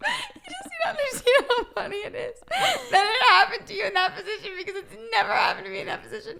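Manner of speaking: high-pitched, helpless laughter through the words; laughing hard. Real, not acted. Host cracking up at her friend's painful mishap, laughing hard. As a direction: squeal it out while cracking up.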